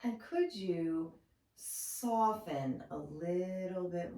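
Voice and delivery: soft meditation guide with long pauses; softly spoken, spacious relaxation voice; guided meditation. Acted. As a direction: guide them gently into stillness, unhurried.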